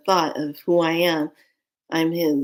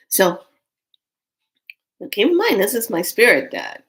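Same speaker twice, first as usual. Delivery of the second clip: ordinary talk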